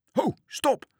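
very high, loud cry to halt; children's shout. A narrator's reading. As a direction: shout after someone running off. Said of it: "schreeuwden de kinderen".

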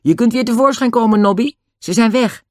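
high, loud call. A narrator's reading. Dick calls Nobby out of hiding, high and loud. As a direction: call to someone hidden away, voice lifted. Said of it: "Dick riep".

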